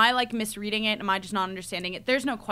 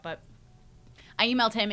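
ordinary delivery

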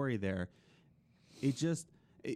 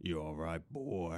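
put-on rough Southern accent; 8 semitones lower, 4 dB quieter than usual. Host showing how easy it is, rough Southern accent. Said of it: "do a rough Southern accent".

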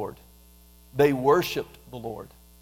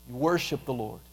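ordinary delivery

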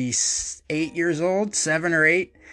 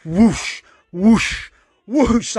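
whoosh sound effect; 3 semitones higher, 4 dB louder, much slower than usual. Retold or imitated. Imitating the flying scissors.

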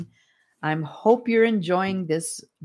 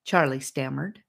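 usual speaking voice

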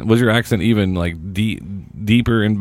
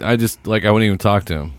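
usual speaking voice

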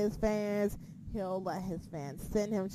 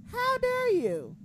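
high mock outrage; 13 semitones higher, slower than usual.

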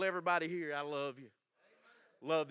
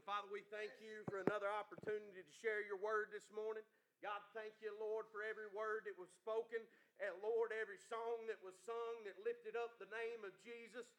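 soft, fervent, much higher prayer; 6 semitones higher, 4 dB quieter than usual. Real, not acted. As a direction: pray intimately, building up. Leads an opening prayer.

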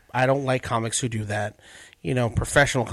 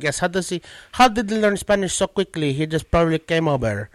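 put-on higher-pitched comic Filipino accent; 5 semitones higher, 4 dB louder than usual. Imagined Filipinos in the crowd discussing the comic, Filipino accent. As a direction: do a puzzled heckler with a Filipino accent. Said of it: "you Filipinos in the crowd like".

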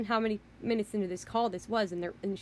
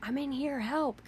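retold scream; 6 semitones higher, slower than usual. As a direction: imitate a cry for help without full volume. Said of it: "Loretta is screaming".

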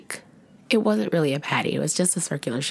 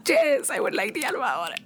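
dreamy yes; 7 semitones higher than usual. Retold or imitated. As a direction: sigh it like she's smitten. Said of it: "I was just like".

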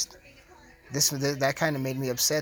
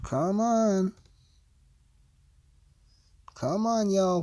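higher, drawn-out coaxing; coaxing plea; 5 semitones higher, much slower than usual. Real, not acted. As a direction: draw it out higher, urging the listeners to answer. Coaxing listeners to answer him.

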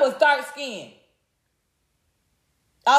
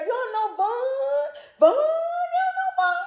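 much higher, sing-song mock question; mocking falsetto; 11 semitones higher than usual. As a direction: sing it up high like a taunt. Mocking impression, high falsetto.

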